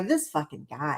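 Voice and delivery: low, soft, exasperated grumble; fed-up reaction. Retold or imitated. Re-enacting friends' reaction when her husband walks in, quietly fed up. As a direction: re-enact a resigned aside under your breath. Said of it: "literally what we do every time".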